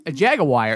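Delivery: Jaguar as JAG-uh-wire; imitated mispronunciation. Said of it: "And he's like".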